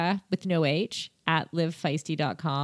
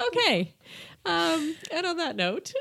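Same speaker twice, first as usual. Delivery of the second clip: laughing; 11 semitones higher than usual. Real, not acted. Still laughing, high pitch.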